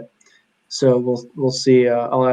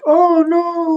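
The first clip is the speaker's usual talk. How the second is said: put-on much higher little-girl voice; imitating toddler; 4 dB louder than usual. Dad voicing daughter comforting crying baby, high toddler voice. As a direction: coo it like a worried toddler. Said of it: "Esperanza will start going".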